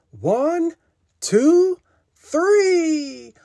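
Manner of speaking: high, rising count; rallying count. A narrator's reading. Animals readying one last push, high building count. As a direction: count up to a big heave.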